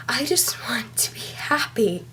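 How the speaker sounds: breathy whisper, barely voiced; whispered plea. Real, not acted. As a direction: let the wish drop to a whisper. Pleading climax of confessional poem.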